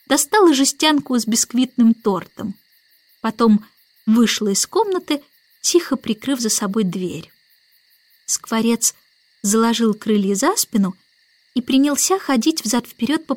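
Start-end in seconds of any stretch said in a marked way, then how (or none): none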